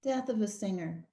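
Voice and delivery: ordinary delivery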